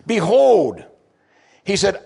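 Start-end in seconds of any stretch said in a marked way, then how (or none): 0.0-0.8 Behold called loud and high; proclaimed. Shown as a demonstration. Preacher demonstrates idou, calling it out loudly. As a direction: announce it. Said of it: "but idou, he says".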